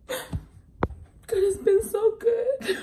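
high, crying through a laugh; teary laughing. Real, not acted. Creator thankful for the trip, teary laugh. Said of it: "I'm getting emotional".

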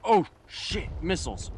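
sudden high alarmed yell; startled shout. Real, not acted. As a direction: yelp as missiles hit. Sudden missile attack.

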